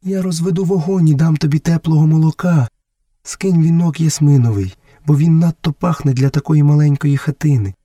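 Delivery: caring mother. Acted. Messua offers her son fire and warm milk, caring mother.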